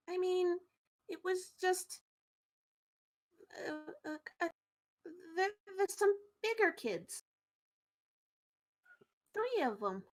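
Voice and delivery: put-on soft, stammering kid reluctant to tell; hesitant kid. Kid naming bigger kids as thieves, soft and hesitant.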